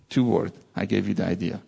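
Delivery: normal talking voice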